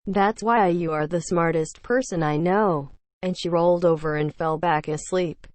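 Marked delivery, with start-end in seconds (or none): none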